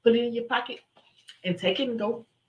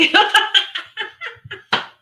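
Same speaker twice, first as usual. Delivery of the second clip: high, loud cackle; delighted high laugh; real laugh; 12 semitones higher, 6 dB louder than usual. Real, not acted. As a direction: burst out laughing. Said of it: "I'm laughing because it's funny".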